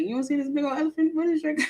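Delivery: high, disbelieving question to a coworker; incredulous to coworker. Retold or imitated. To a coworker about the unnoticed elephant, disbelieving. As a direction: pitch it up in mock disbelief. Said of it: "be like".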